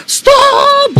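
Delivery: a sudden high shouted stop; mock possessed outburst; sharp yell. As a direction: bark it out like a man losing control. Possessed husband yells out.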